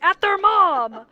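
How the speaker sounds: loud, high, drawn-out indignation; mock outrage. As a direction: exclaim it in scandalized disbelief. Mock outrage at husband's prank.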